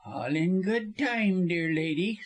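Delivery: harsh, deliberate lawyer voice; harsh put-off. A narrator's reading. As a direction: draw out the first words tauntingly. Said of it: "said Busham harshly".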